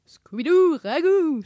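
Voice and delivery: loud, high singsong cartoon voice; singsong Scooby-Doo. A narrator's reading. Scooby answers Sammy's challenge, loud playful singsong. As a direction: sing it out in two falling calls. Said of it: "chantonna-t-il".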